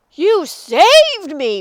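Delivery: slow, loud amazed cry; astonished, grateful exclamation. A narrator's reading. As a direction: gush it out loudly in relief. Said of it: "Big Bark cried".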